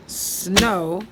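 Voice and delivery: very slow, each syllable drawn out for counting; slow poem reading. Shown as a demonstration. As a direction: read the line slowly so pupils count along. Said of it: "That's five".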